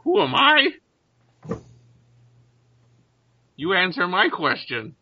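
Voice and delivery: high-pitched, loud bird voice; talking raven. Acted. Raven throws the question back at Sal, squawking voice. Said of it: "the same one goes".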